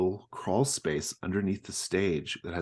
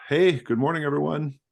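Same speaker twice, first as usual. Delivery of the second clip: higher, louder hearty hello; cheerful manager greeting; 10 semitones higher, 9 dB louder than usual. Acted. Scott greets everyone with cash drawers, higher louder cheery greeting. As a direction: boom a cheery morning at the staff. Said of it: "he's like".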